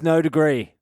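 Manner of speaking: ordinary talk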